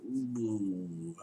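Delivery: usual speaking voice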